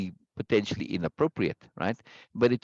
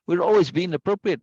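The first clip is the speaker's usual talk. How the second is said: normal talking voice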